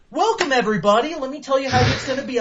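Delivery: high, loud, fast mocking announcer voice; mock orientation host. Mocking the cheery orientation leader, high and fast. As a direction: parody a chirpy orientation leader.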